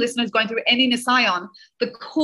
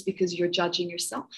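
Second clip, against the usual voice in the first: a soft, hushed inner voice from the soul; much softer quiet inner voice; still small voice; 3 semitones lower, 8 dB quieter than usual. Retold or imitated. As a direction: let it come out hushed, like your own soul answering. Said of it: "still small voice".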